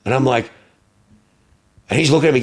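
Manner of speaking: ordinary delivery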